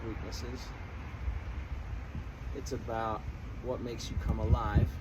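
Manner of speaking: ordinary talk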